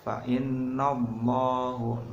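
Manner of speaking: Fa innallahu sung slowly with a heavy, thick lam; thick lam jalalah. Shown as a demonstration. Teacher shows students the thick reading, slow chant. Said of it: "Nah, tebal".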